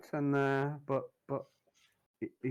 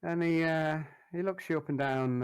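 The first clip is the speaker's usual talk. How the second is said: ordinary talk, nothing marked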